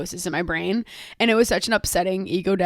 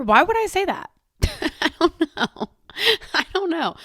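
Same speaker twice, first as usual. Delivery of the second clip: amused; 8 semitones higher than usual. Real, not acted. Amused at own remark.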